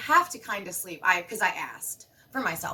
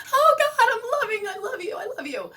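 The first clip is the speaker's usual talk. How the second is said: much higher, gushing and laughing; gushing joy; 12 semitones higher, 6 dB louder than usual. Retold or imitated. Mom gushing love at her kids and husband. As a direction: spill over with giddy love. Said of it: "I'm like".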